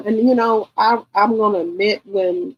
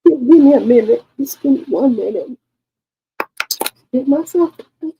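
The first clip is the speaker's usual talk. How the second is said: higher voice, overcome and needing a minute; raised, upset plea for time; emotional; 6 semitones higher, 5 dB louder than usual. Real, not acted. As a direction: ask for a moment while fighting real emotion. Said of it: "I'm getting emotional again".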